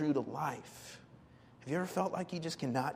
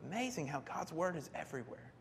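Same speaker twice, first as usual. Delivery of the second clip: usual speaking voice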